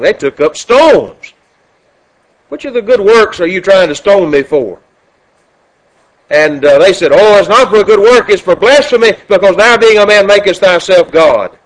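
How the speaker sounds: loud, ringing sermon delivery; preacher booming a gospel reading; preaching at full force. Acted. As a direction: thunder the scripture to the congregation. Preacher reads scripture at full force.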